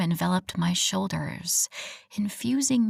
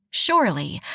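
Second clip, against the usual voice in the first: ordinary delivery, nothing marked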